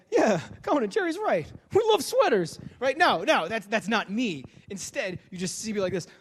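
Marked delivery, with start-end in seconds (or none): 0.1-2.6 high, loud, breezy confidence; cool confident guy. Pretend. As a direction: play it smooth and sure. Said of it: "be like".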